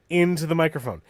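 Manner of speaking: talking through a suppressed sneeze; fighting off a sneeze mid-sentence; holding back a sneeze. Real, not acted. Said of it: "I'm holding back a sneeze".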